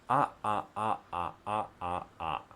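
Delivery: clipped throat-stopped "ah"s trailing off; glottal stop drill, fading. Shown as a demonstration. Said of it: "the practice that you will need".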